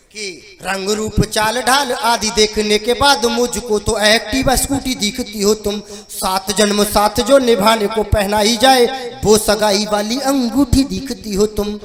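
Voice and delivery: strong, rhythmic stage poetry; verse recitation. Acted. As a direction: declaim it line by line to the crowd. Recites his comic verse. (जोशीला कविता पाठ)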